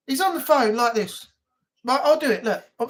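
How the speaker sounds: normal talking voice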